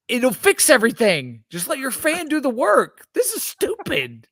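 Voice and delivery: pleading with Nike, voice climbing; frustrated. Real, not acted. Begging Phil Knight to hire fans, frustrated and high. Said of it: "I'm sorry I'm getting so frustrated".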